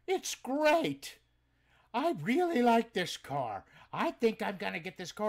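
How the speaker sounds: high, loud eager buyer voice; customer's voice. Retold or imitated. Buyer deciding to buy the car, high delighted voice. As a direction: beam with delight at the junk car. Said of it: "And he goes".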